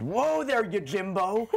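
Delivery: lightly imitated high-pitched call-out; John Larroquette. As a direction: mimic a co-star's teasing call, pitched high. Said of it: "where he goes, "Whoa".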